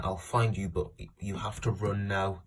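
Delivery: ordinary talk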